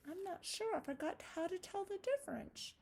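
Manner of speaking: high, curious little spider; puppet spider voice. Acted. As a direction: squeak it as a friendly puppet spider.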